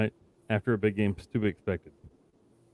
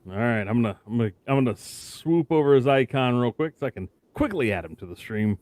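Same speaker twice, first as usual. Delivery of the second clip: ordinary talk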